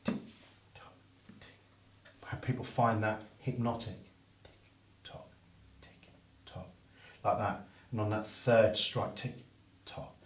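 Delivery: evenly spaced tick and tock; metronome rhythm. Shown as a demonstration. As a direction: count like a metronome. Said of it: "metronome hypnotic".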